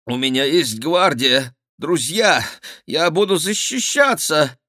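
high, loud, rising protest; cornered king blustering; desperate defiance. A narrator's reading. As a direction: insist in a panicky high voice. Cornered king threatens resistance.

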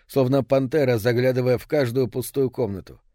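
plain reading voice

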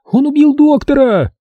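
очень высокий вопль медсестры; вопи визгливо, поднимая тревогу. A narrator's reading. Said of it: "с воплем". (панический вопль медсестры)

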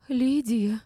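high, loud indignant cry. A narrator's reading. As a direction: exclaim the name in shrill protest. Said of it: "возмутилась Валеева". (возмущённо, высоким голосом)

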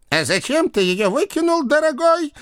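high-pitched sweetly accusing aunt; reproachful aunt. A narrator's reading. Aunt's ghost questions her nephew.

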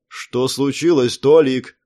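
plain reading voice

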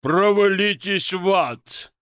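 slow, loud irritated yell; irritated shout. A narrator's reading. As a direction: bellow it from behind a door. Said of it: "раздражённо ответил из-за двери сочный баритон".

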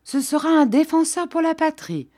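mock-solemn pronouncement, pitched high; a lightly voiced priest playing at gravity; grave tone. Priest solemnly announces to the pregnant Jeanne, mock grave. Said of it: "d'un ton grave".

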